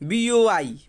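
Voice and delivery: "बी ओ वाई" as separate letter names; wrong way, spelled. Shown as a demonstration. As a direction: sound out each letter's name in "बी ओ वाई". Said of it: "हम तो ऐसे नहीं ना बोलेंगे". ("बी ओ वाई" अक्षरों के नाम से गलत पढ़ना)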